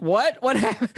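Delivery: high startled what, rising; mock shock at the door; shocked disbelief. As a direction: caught off guard at the door. Confused at being left off list.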